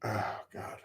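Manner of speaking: emotional. Real, not acted. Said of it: "I apologize for getting emotional".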